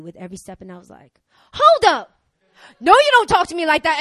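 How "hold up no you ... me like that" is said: high, loud indignant outburst; angry retort, acted. Woman turning back on the man who cursed her, hammed-up indignation. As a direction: call him out loudly, playing it big.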